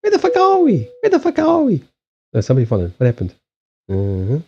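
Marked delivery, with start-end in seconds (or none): 0.0-1.8 very high-pitched bird-call impression; Weather Fakawi bird. Streamer likens someone to the Weather Fakawi bird, mimicking its high call. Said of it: "He's like the Weather Fakawi bird".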